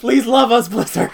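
loud, high plea breaking into laughter; mock pleading. Podcaster begs Blizzard for love, mock-desperate and laughing. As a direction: beg jokingly, laughing at the end. Jokingly begging the game company.

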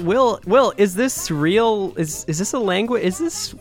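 can't believe your ears; incredulous. Real, not acted. Host baffled by gibberish song.

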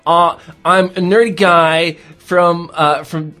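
slow, put-on nerdy American; hesitant, drawn-out American accent; American character accent. Shown as a demonstration. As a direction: drawl it out like a nerdy American guy. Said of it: "what is your character when you're American".

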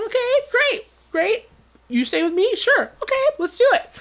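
high, loud rapid-fire excited back-and-forth. Retold or imitated. Planning the trip with his friend, high excited retelling. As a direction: bubble over with eager agreement.

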